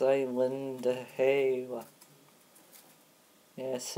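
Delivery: usual speaking voice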